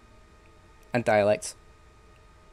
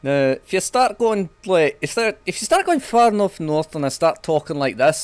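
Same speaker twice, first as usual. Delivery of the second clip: higher, louder far-north accent demo; northern accent; 5 semitones higher, 5 dB louder than usual. Shown as a demonstration. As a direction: show a Scottish-leaning northern English accent. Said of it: "a wee bit of trouble communicating".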